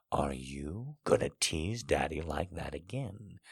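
low, hushed question in a man's voice; teasing male lover. A narrator's reading. Man asking his lover about teasing, low and hushed. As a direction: drop low and very soft.

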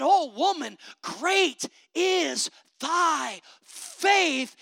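slow, drawn-out blessing from Jesus; lightly voiced Jesus, stretched out and solemn; Jesus praising. Jesus answering the woman, slow and solemn. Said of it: "And Jesus said".

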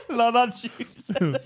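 loud, high, helpless laughing speech; cracking up laughing. Real, not acted. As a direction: squeeze the words out through laughter. Cracking up at friend's babbling.

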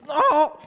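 high, loud acted cry; mock yelp of pain. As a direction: yelp as if caught in a zip. Acts out nipple caught in zip.

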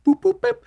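high little electronic boops; beeping contraption. Retold or imitated. Narrator imitating the gadget covid lifts up, high beeping. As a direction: imitate button beeps high up. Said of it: "some contraption and goes".

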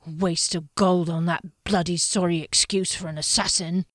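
rough, disdainful henchman; gruff henchman voice. A narrator's reading. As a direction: spit out the complaint.

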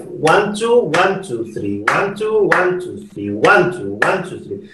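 one two, one two three, stressing each one; counting a five. Shown as a demonstration. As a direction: count it out clearly for the students. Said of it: "let's count a five".

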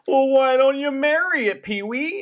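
hammed-up jeering crowd; mock taunting shout. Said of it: "And they go".

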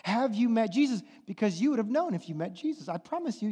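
normal talking voice

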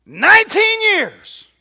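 high, loud, stretched out; preached cry. Acted. Preacher crying out the long wait to the congregation, loud and drawn out. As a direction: cry the years out slowly.

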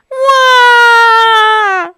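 put-on high, loud babyish wail; bear crying wail. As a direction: bawl like a hurt bear cub. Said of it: "cried the bear".

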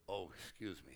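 quiet, low embarrassed apology; sheepish quiet. Retold or imitated. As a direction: apologise under your breath. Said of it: "he said".

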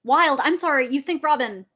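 ordinary talk

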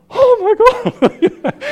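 high, loud burst of relief; relieved shriek. Retold or imitated. The driver's door opens, shrieked relief. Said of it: "I was like".

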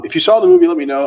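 loud, high call to the audience. Real, not acted. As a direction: shout out to the chat. Calling out to his viewers.

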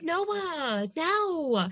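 high, drawn-out mock whine; hammed-up high protest; mock pleading. Acts her pleading with Noah.

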